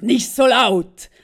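a very loud, slow imitated rebuke; loud retold official. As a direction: bellow it slowly, mimicking the clerk. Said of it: "Aber er ist me- ... mega laut gewesen". (sehr laut und langsam nachgemachter Zollbeamter)

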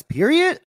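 PERIOD punched high and loud; emphatic period. Real, not acted. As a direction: land "period." hard and high. Emphatic end of quote.

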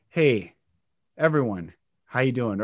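low greeting with pauses between phrases; slow, broken cadence. Shown as a demonstration. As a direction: pause after each phrase, keep it low.

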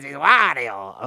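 hammed-up cartoon villain impression, loud at the start; Wario. Podcaster showing co-host how a game character talks, hammed-up impression. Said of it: "was that good? I tried".

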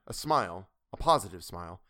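ordinary delivery, nothing marked

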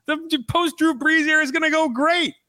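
high, gushing mock-hype voice; mock fan excitement. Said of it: "everyone was like".

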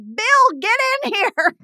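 high-pitched mock summons; calling out. Alarmed mom summoning dad to the kitchen, acted call-out. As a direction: imitate a mom calling her husband in.

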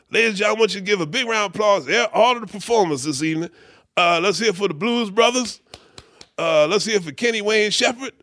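high, rapid stage announcer; hammed-up show roll call, pitched high. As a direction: imitate a bandleader calling for applause. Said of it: "[name] is o-, on stage. He says".

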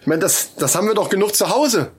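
ordinary delivery

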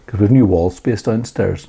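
ordinary talk, nothing marked

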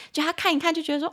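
ordinary delivery

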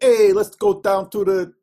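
loud, high Chicago put-on; mock Chicago buddy voice; hammed-up Chicago cheer; playful Chicago accent. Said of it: "that's a terrible example".

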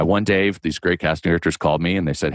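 ordinary delivery

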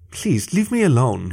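slow, small, frightened plea; whimpering plea. A narrator's reading. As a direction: beg the bullies to stop, half-hearted whimper. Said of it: "Lorenzo whimpered".

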